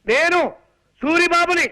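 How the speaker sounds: loud announcement. Acted. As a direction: call it out for someone hard of hearing. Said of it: "అంత గట్టిగా అరవకు నాయనా".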